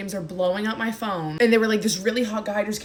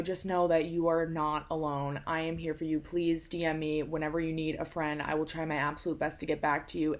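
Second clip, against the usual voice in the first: soft, lower, sincere voice; gentle reassurance; 3 semitones lower, 8 dB quieter than usual. Real, not acted. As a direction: quietly comfort someone who feels alone. Reassures viewers they are not alone.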